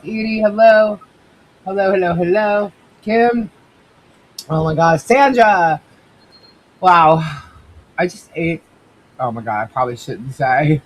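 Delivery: normal talking voice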